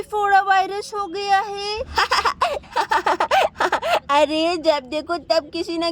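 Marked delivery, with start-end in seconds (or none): 1.8-4.1 high mocking giggle; teasing child laugh. Son laughing at his father's swollen backside. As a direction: laugh at dad's misfortune.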